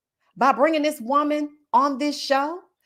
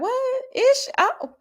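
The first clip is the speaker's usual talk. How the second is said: Nene; 12 semitones higher, slower than usual. Retold or imitated. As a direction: act out a diva's shocked comeback. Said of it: "She was like".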